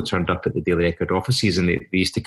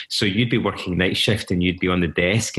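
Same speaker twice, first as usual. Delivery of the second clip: ordinary delivery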